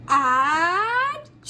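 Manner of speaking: big theatrical achoo; hammy sneeze. Acted. Bear's sneeze acted.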